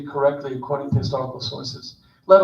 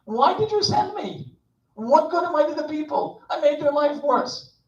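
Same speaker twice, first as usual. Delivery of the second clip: Moses arguing; 11 semitones higher than usual. Retold or imitated. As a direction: whine up at God in despair. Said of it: "Moses argued with God".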